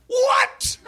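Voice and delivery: put-on high shocked yell, Jamaican accent; Jamaican cab driver. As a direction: cry out in outlandish Jamaican disbelief. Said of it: "I picture Sebastian the Crab and I yell".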